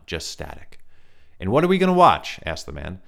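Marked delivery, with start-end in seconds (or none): 1.4-2.4 high loud reedy question; playful probing stranger; light reedy stranger. A narrator's reading. Stranger asking Ryan about the tapes, high reedy voice. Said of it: "light, reedy voice".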